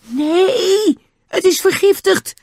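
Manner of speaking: very high, strained cry of alarm; Nobby's horrified scream. A narrator's reading. Boy sees his dog poisoned, high panicked scream. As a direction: cry out in panic, far above normal pitch. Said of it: "schreeuwde Nobby".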